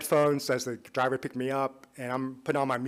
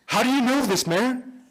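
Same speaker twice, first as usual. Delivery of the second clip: much higher, louder amazed cry; stunned question; 12 semitones higher, 8 dB louder than usual. Retold or imitated. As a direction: blurt it out in disbelief. Driver stunned.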